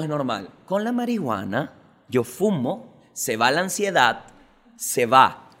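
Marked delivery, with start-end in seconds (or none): none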